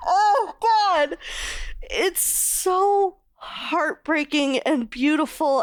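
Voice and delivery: choking up, voice cracking high; on the verge of real tears; tearful. Real, not acted. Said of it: "I'm gonna start crying".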